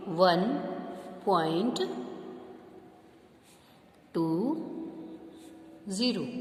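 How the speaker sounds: वन, point, टू, zero said one by one with long pauses; dictating digit by digit. Shown as a demonstration. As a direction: dictate each digit slowly, pausing between. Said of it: "इसको आप लिखेंगे". (धीरे-धीरे एक-एक अंक बोलते हुए)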